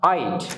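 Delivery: I'd said as one syllable, eye-d; pronunciation of I'd. Shown as a demonstration. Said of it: "Look at the pronunciation of".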